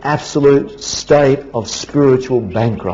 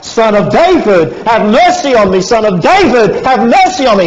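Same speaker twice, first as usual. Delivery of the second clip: higher, louder pleading cry; a beggar's raised plea for mercy; 8 semitones higher, 4 dB louder than usual. Retold or imitated. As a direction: cry out over the crowd, begging. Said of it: "he continually cried out".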